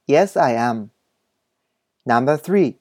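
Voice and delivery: slow, carefully spaced model English reading; お手本の英文音読. Shown as a demonstration. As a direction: read each sentence slowly for learners to repeat. (英語のお手本をゆっくり音読)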